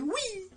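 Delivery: small, high, soft mock squeal; tiny playful squeal of someone flying; imitated "whee". Commentator mimics Booker in flight, soft high mock whee. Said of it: "He's like".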